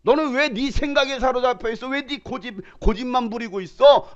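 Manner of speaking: exasperated scolding; scolding God. Acted. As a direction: scold the stubborn one. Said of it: "라고 하나님 말씀하시는 거예요".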